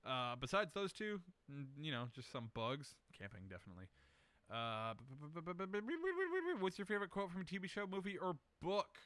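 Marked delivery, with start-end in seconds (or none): 5.1-6.6 a playful b-b-b sliding up into a high squeak; mock stutter rising high; goofy stutter. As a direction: stall for time with a silly stammer that climbs. Silly stalling before next question.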